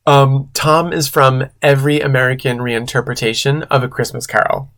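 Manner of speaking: ordinary talk, nothing marked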